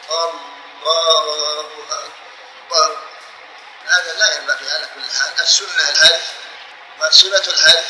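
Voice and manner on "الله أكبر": very slow, every long vowel dragged out, trailing softer; over-stretched takbir. Shown as a demonstration. Teacher demonstrating the disliked stretching, extremely drawn-out takbir. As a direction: drag "الله أكبر" out to an exaggerated length. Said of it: "وإن مطط التكبير كره".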